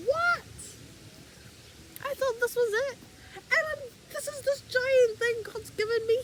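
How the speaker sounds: high, astonished squeals of what; shocked at a huge surprise; amazed disbelief. Pretend. Gardener pulling out a giant carrot, high and amazed.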